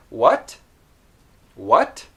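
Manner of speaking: "What" ending in a clearly released t; "What" with the final t fully sounded. Shown as a demonstration. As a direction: let go of the t at the end of "What" with a puff of air. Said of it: "with a released T".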